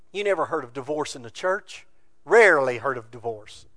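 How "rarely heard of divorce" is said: ordinary talk, nothing marked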